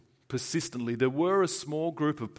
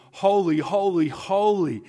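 higher and louder proclamation; seraphim cry; 6 semitones higher, 6 dB louder than usual. Acted. Seraphim calling out before God's throne, high and loud. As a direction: ring out each holy.

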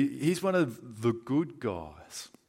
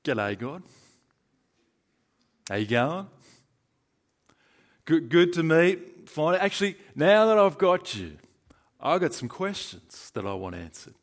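a hint of a hearty Aussie bloke, rising and louder mid-way; mildly imitating a mate greeting God; bloke meeting God; 3 semitones higher, 4 dB louder than usual. Aussie bloke greeting God face to face, lightly hammed-up. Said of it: "the classic Aussie type".

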